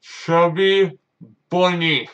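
ordinary talk

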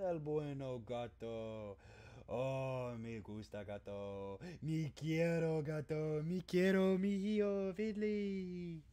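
softly crooned broken Spanish; mock Spanish. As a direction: play a doting Spanish parent.

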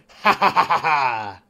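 slow, loud, hammed-up "ha ha"; a big mocking stage laugh; loud fake laugh. Mocking the sim's text message, loud fake laugh.

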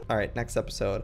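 ordinary delivery, nothing marked

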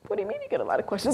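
high, fast, puzzled senior colleague; quick incredulous colleague; skeptical colleague. Retold or imitated. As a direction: fire back the question in disbelief. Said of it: "senior male colleagues were like".

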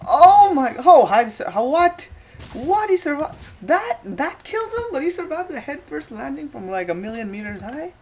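high, loud disbelieving shout; stunned yelling at a ragdoll surviving a huge fall; shocked outburst. Real, not acted. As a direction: yell in utter disbelief at the crash.

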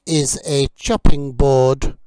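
slow, loud, carefully clear teacher drill; vocabulary drill. Acted. Teacher drilling kitchen words for learners, slow and clear. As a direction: model each word for learners to repeat.

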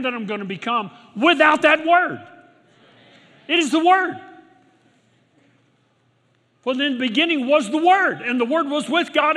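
high, loud bursts on key phrases; full-force preaching. Real, not acted. Preacher urging that it is the Word, full-force preaching.

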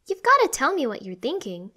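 high, loud, concerned sister voice. A narrator's reading. Sister urging upset Aiden to open up, concerned. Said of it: "clearly concerned".

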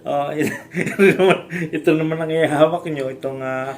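giggling through the words, pitch jumping high; can't stop laughing while explaining. Real, not acted. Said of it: "Sorry, ako natatawa". (natatawa habang nagsasalita)

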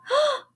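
high, quick acted gasp; startled gasp. A narrator's reading. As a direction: gasp in surprise. Annie spots the parrot.

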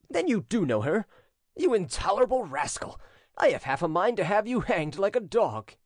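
high, indignant master's outburst; angry threat. A narrator's reading. Prince threatening to hang his servant, angry. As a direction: scold and threaten, pitched well up.

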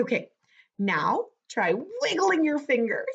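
usual speaking voice

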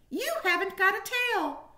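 tiny squeaky high mouse voice; little mouse. A narrator's reading. Mouse guarding its home from a stranger, high squeak. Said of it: "said another".

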